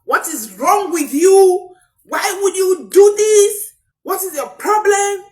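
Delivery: high, loud, slow scolding; exasperated yelling. Retold or imitated. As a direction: yell it at the child like you've lost patience. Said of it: "what we are going to say is".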